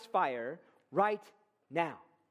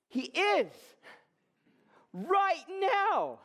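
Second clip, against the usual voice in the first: preaching shout; 8 semitones higher, much slower than usual. Real, not acted. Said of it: "sort of shouting at you".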